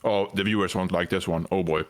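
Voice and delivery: normal talking voice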